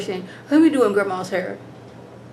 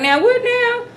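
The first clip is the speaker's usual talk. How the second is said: much higher, disbelieving squawk; incredulous Cookie; 12 semitones higher than usual. Retold or imitated. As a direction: fire it back in total disbelief. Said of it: "Cookie's like".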